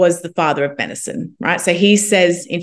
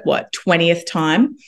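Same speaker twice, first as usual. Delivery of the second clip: normal talking voice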